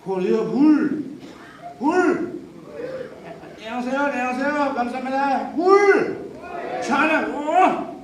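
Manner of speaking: loud high calls of 물 to the crowd; 큰 소리로 외치는 인사; shouted greetings. Real, not acted. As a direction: shout out to the Korean fans. Shouting greetings to Korean fans.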